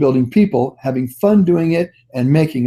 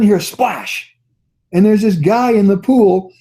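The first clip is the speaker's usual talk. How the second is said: ordinary talk, nothing marked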